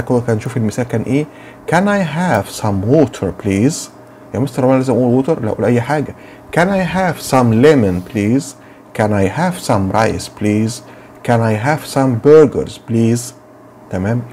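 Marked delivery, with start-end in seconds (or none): 1.7-3.9 very slow, clear request; slow classroom modelling; slow model sentence. Shown as a demonstration. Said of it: "teacher models example". 6.6-13.3 very slow example requests; slow classroom modelling; slow model sentences. Shown as a demonstration. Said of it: "teacher lists examples".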